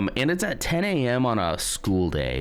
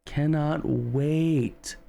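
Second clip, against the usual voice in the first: high, very drawn-out "cannot wait"; eager emphasis; 8 semitones higher, much slower than usual. Real, not acted.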